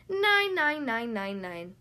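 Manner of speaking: loud burst tailing away; screamed, then fading. A narrator's reading. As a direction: chant it, running down like an echo. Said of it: "Thistle screamed".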